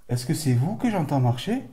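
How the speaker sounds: loud, high call into the dark. Real, not acted. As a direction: call out to the unseen. Calling out to spirits.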